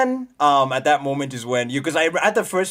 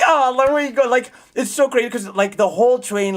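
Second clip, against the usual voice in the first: high, loud excited rush; can't contain the hype; 11 semitones higher, 6 dB louder than usual. Real, not acted. Streamer recounting the train fight to chat, excited.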